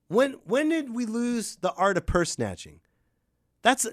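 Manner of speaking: ordinary talk, nothing marked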